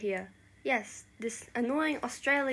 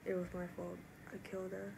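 low, hushed admission of guilt; quiet, weighed down with guilt; guilty confession; 3 semitones lower, 10 dB quieter, faster than usual. Acted. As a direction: confess it very softly. Confessing a killing to friends.

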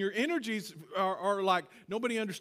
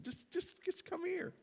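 higher, softer beckoning; soft invitation; 10 semitones higher, 8 dB quieter than usual. Shown as a demonstration. Preacher showing God's invitation, higher and soft. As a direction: coax them gently to come. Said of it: "it's an invitation".

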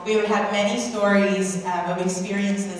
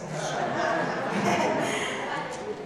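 laughing; 5 semitones higher, 6 dB quieter than usual. Real, not acted. Laughs at own joke.

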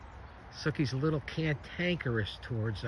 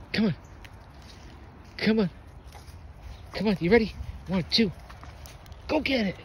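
excited dog play; 6 semitones higher, faster than usual. Real, not acted. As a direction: pump up the dog before the throw. Hyping the dog to fetch.

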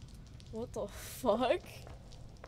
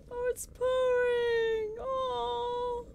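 higher, slow, choked-up gush; squealing delight; 12 semitones higher, much slower than usual. Real, not acted. Streamer hears the cat purr, squealing delight. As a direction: melt over a purring cat. Melting over purring cat.

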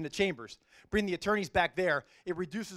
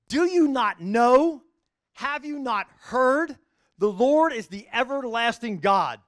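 slow, loud, declaiming the verse; scripture reading; 6 dB louder than usual. Acted. Judge reciting his life verse to the audience, proclaiming. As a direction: proclaim it like a preacher. Reads Isaiah passage aloud.

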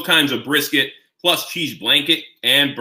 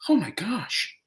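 breathless whispered gasp of awe; awed whisper; 6 semitones higher, 6 dB quieter, slower than usual. Real, not acted. Vlogger overwhelmed by the bite, whispering in awe. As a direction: whisper it, stunned by the taste.

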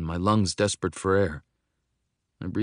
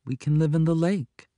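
put-on higher-pitched voice of a little girl; simple, childlike statement; 9 semitones higher, 3 dB louder than usual. A narrator's reading. Young girl proposing life in the lake, simply. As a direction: offer it up simply, pitched high. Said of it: "she says simply".

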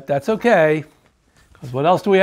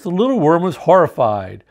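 plain reading voice

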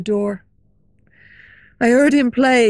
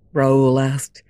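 usual speaking voice

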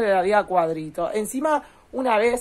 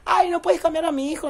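higher whiny voice; mock whiny daughter-in-law; 8 semitones higher than usual. A lazy young mother begging off to her mother-in-law, whiny. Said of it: "le decía a la suegra".

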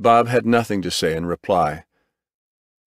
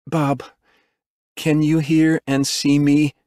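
higher, slow and deliberate; medic checking consciousness; 7 semitones higher than usual. A narrator's reading. Medic to a dazed patient, slow and careful. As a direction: ask slowly and clearly, pitched up.

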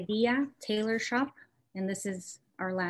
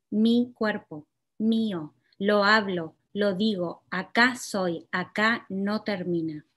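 measured, deliberate poetry reading; poem reading; 4 dB louder, faster than usual. Acted. Poet reading her own poem to the audience, measured.

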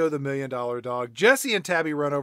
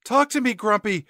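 high, hammed-up pleading, building louder; mock desperate plea; 10 semitones higher, 8 dB louder than usual. Crystal begging her cat to speak, mock desperate. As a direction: beg melodramatically, stretching the end.

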